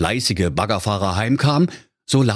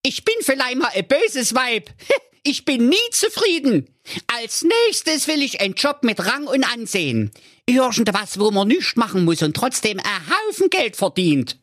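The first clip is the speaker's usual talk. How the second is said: very high wife voice with a laugh; laughing wicked wife. A narrator's reading. Greedy wife gloating to her husband, very high laughing voice. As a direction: gloat with a cackle in it. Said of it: "lachte nur".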